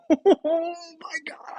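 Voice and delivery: high, airy, breathless gasp; breathless whisper-shriek. Real, not acted. Gamer gasping at the roll, breathless.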